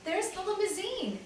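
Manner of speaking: put-on child spotting the car, excited call; excited cry. A narrator's reading. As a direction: point it out, eager. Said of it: "Benny cried".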